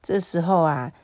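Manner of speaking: usual speaking voice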